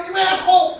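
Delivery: high mock yell; retold yell. Driver yelling out the window when cut off, mock angry. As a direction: shout it like road rage. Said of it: "they yell at the window".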